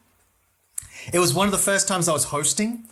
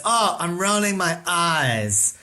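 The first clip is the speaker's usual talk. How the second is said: long drawn-out, bored groan of words; imitating a bored, dismissive heckler; 2 semitones higher, much slower than usual. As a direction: drag it out as if deeply unimpressed. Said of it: "she was bored".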